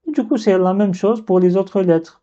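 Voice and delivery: usual speaking voice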